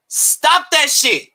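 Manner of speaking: slow scolding. Real, not acted.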